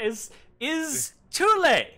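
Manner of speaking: mock-dramatic cry climbing high and loud; hammed-up insistence. Game master refusing players' backtracking, mock-dramatic rising cry.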